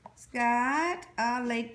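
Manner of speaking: ordinary talk, nothing marked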